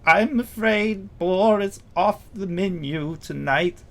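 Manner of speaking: high, slow, wistful gnome voice; about to cry. Acted. Gnome cook mourning his rejected boar, near tears. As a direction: hold back tears, drawing it out. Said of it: "like he's about to cry".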